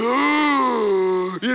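long, loud, high mock-panic cry; loud acted freak-out noise; panicked wail. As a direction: let out a drawn-out, hammed-up nervous wail. Acting out panicked nerves.

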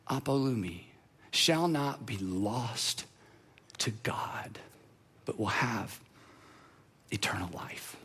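softening to a near whisper; hushed scripture. Acted. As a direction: let the verse fade to a hush. Quoting verse, hushed.

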